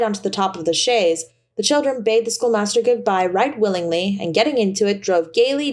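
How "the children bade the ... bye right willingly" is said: plain reading voice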